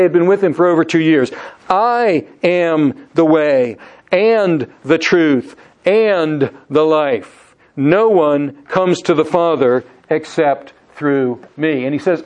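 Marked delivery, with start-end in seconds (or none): 1.7-11.8 slow, weighty reading of the verse; slow, reverent scripture quote; slow solemn quote. Shown as a demonstration. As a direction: lay each phrase down slowly. Key verse quoted slowly.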